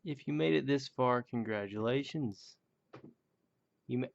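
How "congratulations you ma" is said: ordinary talk, nothing marked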